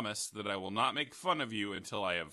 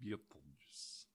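put-on very low, slow Russian accent; 11 semitones lower, 17 dB quieter, slower than usual. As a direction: drag it out, deep Russian accent. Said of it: "saying Beetlejuice in a Russian accent".

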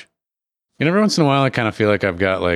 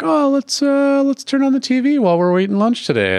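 high, chirpy teacher voice; cheerful teacher. Retold or imitated. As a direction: sweetly suggest it like a teacher. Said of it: "they're like".

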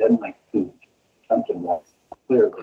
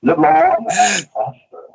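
Spock impression; 6 semitones higher, slower than usual. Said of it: "Star Trek salute".

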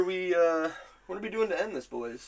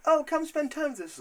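wife voice; 14 semitones higher than usual. Retold or imitated. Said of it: "The wife's like".

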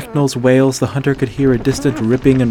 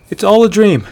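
calling out; 9 semitones higher, 8 dB louder than usual. Acted. Hunter calls to lost friend.

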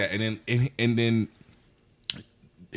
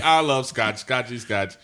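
higher and louder Anchorman quote; mock movie line; 5 semitones higher, 5 dB louder than usual. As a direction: belt the scotch line for laughs.

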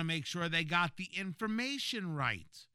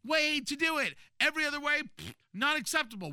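mocking voice; 9 semitones higher, 4 dB louder than usual. Mocking Democrats' stance.

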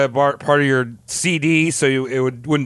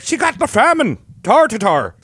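put-on Irish accent; 6 semitones higher than usual. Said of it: "dumb Irish fucks that were like".

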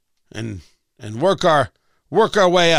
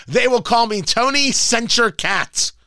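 usual speaking voice

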